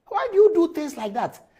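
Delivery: high, loud, exasperated demand; indignant outburst. Real, not acted. Host rebuking a journalist who insults on TV, indignant outburst. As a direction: cry out the question in indignation.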